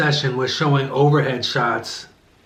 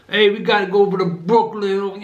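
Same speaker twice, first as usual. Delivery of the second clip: mock New York cop, pitched up; hammed-up, higher New York accent; overdone New York accent; 5 semitones higher than usual. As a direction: lay the New York accent on too thick. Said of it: "That New York accent was not good".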